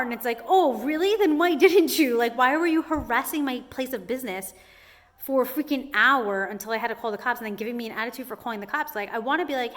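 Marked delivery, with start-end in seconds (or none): 0.5-8.9 furious mock comeback with a laugh; indignant. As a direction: let him have it, exasperated. Angry retort at the inspector.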